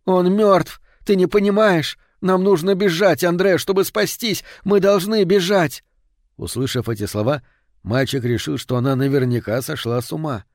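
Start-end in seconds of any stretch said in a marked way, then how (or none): 0.0-5.8 high, raised, frantic mother's cry; desperate shouting. A narrator's reading. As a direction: shout to make the boy understand. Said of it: "и прокричала". (мать отчаянно кричит сыну)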